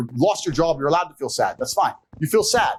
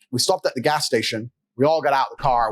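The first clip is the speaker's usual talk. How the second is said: ordinary talk, nothing marked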